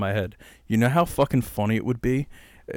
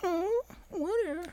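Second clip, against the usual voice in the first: high, hushed creepy voice; 10 dB quieter than usual. Acted. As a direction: breathe it like a horror villain. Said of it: "Sounds like a setup to a scary movie".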